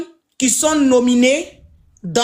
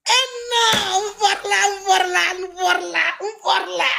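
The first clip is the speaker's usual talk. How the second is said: mock announcer; 7 semitones higher than usual. Mock announcer flourish, high.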